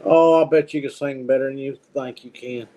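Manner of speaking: ordinary talk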